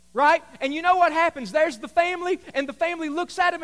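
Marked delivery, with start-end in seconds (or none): none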